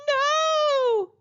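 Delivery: high, loud wailing "No!"; a frightened young shark crying out. Acted. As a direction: wail it like a scared child. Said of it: "Flossie wailed".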